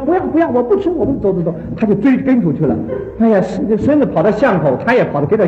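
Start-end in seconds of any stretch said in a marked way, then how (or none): 0.1-1.6 higher-pitched imitation of a hurried boy; grandson protesting. Lightly voiced grandson refusing breakfast from grandma. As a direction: brush grandma off, in a rush. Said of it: "那孙子说".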